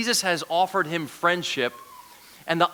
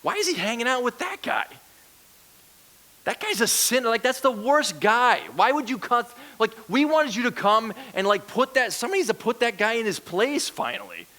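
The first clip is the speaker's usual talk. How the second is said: mock-indignant complaint, pitched up; higher-pitched, incredulous crowd grumbling; grumbling and complaining; 6 semitones higher than usual. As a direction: protest like an affronted onlooker. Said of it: "so they're grumbling and complaining".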